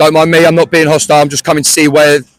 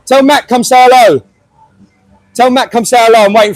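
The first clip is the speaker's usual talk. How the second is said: calling from far away; 6 semitones higher than usual. Real, not acted. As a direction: call out across the gate. Shouting for Mac across gate.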